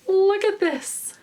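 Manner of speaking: excited. Real, not acted.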